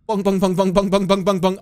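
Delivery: repeated bung imitating a bounce; bouncing sound. Streamer to chat, imitating a bouncing clip with bung bung. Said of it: "and it bounced".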